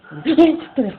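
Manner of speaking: loud, high swearing in alarm; real startled yelp. Real, not acted. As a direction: jump and yell it out. Startled in the game. (cri de surprise paniqué)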